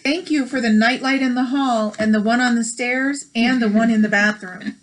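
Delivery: bright storybook read-aloud; gentle picture-book narration; reading aloud to children. Acted. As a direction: read it like story time for kids.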